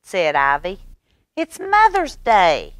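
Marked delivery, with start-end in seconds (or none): 1.4-2.8 put-on high, loud, eager little girl; high bright child calling her brother; Ivy's bossy little-girl voice. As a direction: wake him up like an excited big sister. Ivy's voice in the story.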